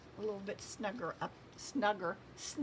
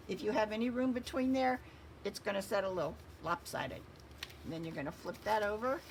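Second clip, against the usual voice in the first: usual speaking voice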